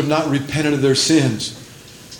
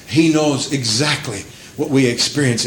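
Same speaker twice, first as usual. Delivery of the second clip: normal talking voice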